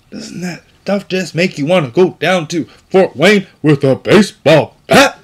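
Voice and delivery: angry. Real, not acted. Angry about the killer.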